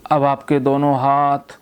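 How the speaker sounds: measured hypnotist's delivery, stretched key words and long pauses; hypnotic induction. Real, not acted. Therapist telling a patient their legs and hands loosen, hypnotic induction. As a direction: lull them slowly, letting each phrase sink away.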